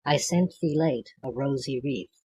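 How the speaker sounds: normal talking voice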